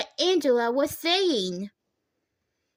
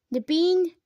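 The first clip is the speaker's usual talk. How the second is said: ordinary delivery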